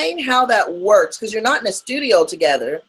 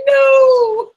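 high wailing no; 6 dB louder than usual. Retold or imitated. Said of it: "He's like".

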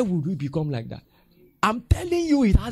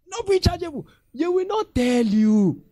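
mock member; 13 semitones higher, 6 dB louder than usual. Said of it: "member assures pastor".

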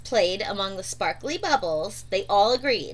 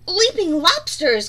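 higher, louder exclamation; crying out; 6 semitones higher, 7 dB louder than usual. A narrator's reading. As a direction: blurt it out in alarm. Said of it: "cried Turtle".

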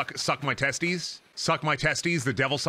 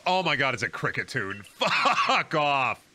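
ordinary talk

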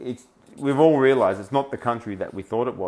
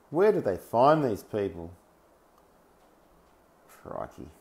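ordinary talk

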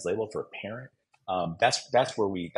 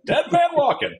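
high and loud, half-joking yelp; mock alarmed exclaim; 8 dB louder than usual. Husband realizing his blunder in the delivery room, high mock yelp. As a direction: yelp it like a doomed man.

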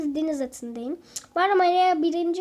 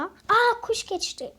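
surprised; 6 semitones higher, slower than usual. Real, not acted. Sees a bird fly past.

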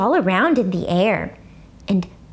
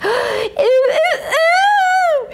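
high, loud shocked hollering; 4 dB louder, much slower than usual. A narrator's reading. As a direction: holler like a horrified kid. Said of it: "she hollered real loud".

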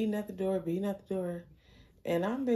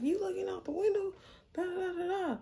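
much higher, mocking imitation of an angry guy; mocking Mark; 13 semitones higher than usual. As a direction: parody him going off at the window. Mimicking Mark yelling from outside.

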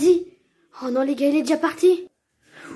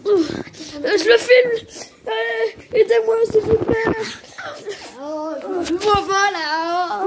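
high, frightened, half-crying pleading; scared captive voice; 7 semitones higher, 5 dB louder, slower than usual. Acted. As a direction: beg in a high, teary captive voice.

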